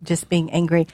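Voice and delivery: ordinary talk, nothing marked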